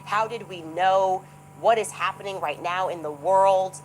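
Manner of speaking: half-mocking panic at what just happened; retold freaking out. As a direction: relive the shock, drawing each question out. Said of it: "We were freaking out, going".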